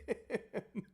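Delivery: usual speaking voice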